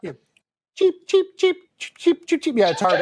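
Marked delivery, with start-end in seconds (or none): 0.8-2.6 high chirpy little bird; cartoon bird voice. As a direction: chirp it out like a tiny Blue Jay. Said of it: "can you give me a Blue Jay sound effect".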